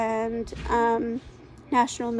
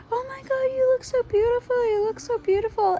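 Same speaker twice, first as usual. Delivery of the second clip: very high, gushing friend voice; imitating gushing friend; 12 semitones higher than usual. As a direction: squeal it like an adoring friend. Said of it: "the first thing she says".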